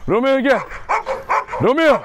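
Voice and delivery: high call to a dog. Real, not acted. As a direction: call the dog across the yard. Calling the dog from afar. (köpeği uzaktan yüksek sesle çağırma)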